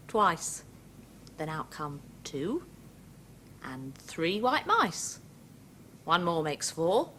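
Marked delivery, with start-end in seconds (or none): none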